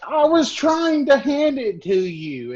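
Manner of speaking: high, slow, pleading victim voice; acting the victim. Player retelling her character's fake plea to the guard. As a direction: whine it out like the wronged one. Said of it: "she's kind of like".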